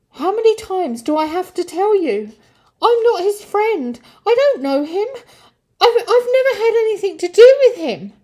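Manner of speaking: very high, loud frantic denial; frantic shouting, rising to a rush; panicked shout. Acted. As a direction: shriek it, desperate and cornered. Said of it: "shouted Peter, panicking, frightened, confused".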